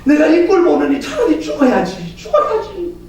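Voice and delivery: high mock wail of grief; keen like a heartbroken mother on the ground; mother collapsed on the ground, wailing in hammed-up grief; wailing lament. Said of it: "땅에 주저앉아갖고".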